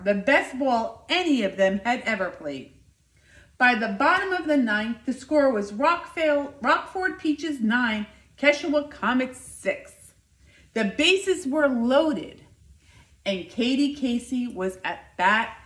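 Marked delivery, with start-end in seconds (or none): none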